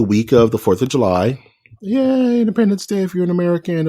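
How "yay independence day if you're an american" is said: high, fast mock celebration; mock cheer. Real, not acted. Host announces holiday question, high mock cheer.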